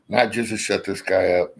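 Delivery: ordinary talk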